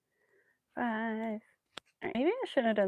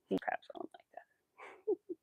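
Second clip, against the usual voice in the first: whispered aside; 10 semitones higher, 10 dB quieter, slower than usual. Real, not acted. Aside about friend's teasing.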